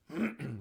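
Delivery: short chesty throat clear. Real, not acted. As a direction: clear the throat, rough and quick. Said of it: "grunting a little bit still".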